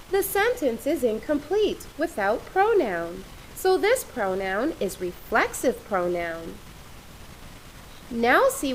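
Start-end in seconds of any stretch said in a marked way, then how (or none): none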